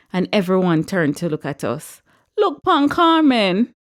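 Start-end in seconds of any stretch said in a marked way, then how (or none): 2.4-3.7 high, slow excited call in patois; drawn-out cry of glee. A narrator's reading. Lucy calling everyone to look.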